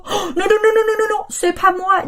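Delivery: high repeated no's; dog denies waking the child; dog's denial. Acted. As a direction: protest over and over. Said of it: "dit le chien".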